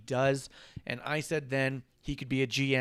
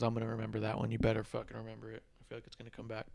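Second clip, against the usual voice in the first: quiet menacing aside; 3 semitones lower, 10 dB quieter, much faster than usual. Retold or imitated. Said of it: "He goes".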